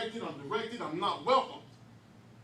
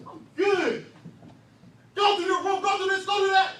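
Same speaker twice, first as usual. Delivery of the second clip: much higher and louder barked orders; yelling parent; 13 semitones higher, 14 dB louder than usual. Retold or imitated. Parent shouting orders at the kid coming home. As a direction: bark the chores at him. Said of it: "Mike is like".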